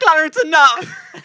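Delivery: high, loud hammed-up shriek; mock hysterical scream. Pretending to be girls in the car, mock shriek. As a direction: shriek for him to stop.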